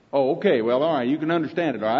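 ordinary delivery

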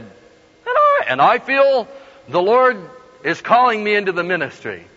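high, loud opening that settles down; grand proclamation. Retold or imitated. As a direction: declare it grandly to the congregation, phrase by phrase. Boy announces calling to congregation.

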